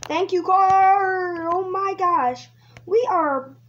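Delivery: high, loud, stretched-out thrill; excited cheer. Real, not acted. Player thanking the car, high excited cheer. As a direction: cry out, thrilled by the speed.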